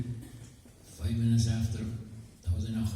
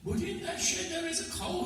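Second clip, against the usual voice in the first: much higher put-on voice; guest's voice. Second guest complaining about the cow, very high voice. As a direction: squeak out the objection up high.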